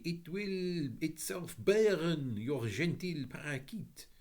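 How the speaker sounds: put-on high, French-accented lady's welcome. A narrator's reading. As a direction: greet them in a lilting French accent. French lady speaks.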